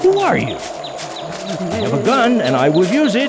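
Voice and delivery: high, loud, scared warning; frightened threat. Acted. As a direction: bluff bravely while terrified. Armed hunter threatens unseen stranger.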